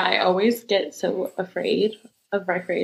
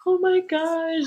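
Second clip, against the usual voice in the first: high, frightened little squeak; scared little squeak; 9 semitones higher, slower than usual. Retold or imitated. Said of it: "this scared little".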